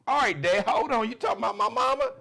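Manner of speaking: mock son stepping up for his mama; protective son. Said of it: "his son's like".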